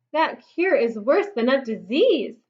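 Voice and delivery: plain reading voice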